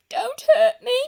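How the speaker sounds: high, loud frightened plea; whimpering. Acted. Lion backing away from the mouse, frightened whimper. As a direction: beg like a terrified big cat. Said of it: "he whimpered".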